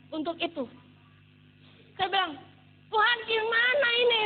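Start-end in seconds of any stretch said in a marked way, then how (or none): 2.9-4.2 high, loud plea to God; exasperated cry. Retold or imitated. Struggling worker crying out to God, exasperated. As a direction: call up to God in retold exasperation.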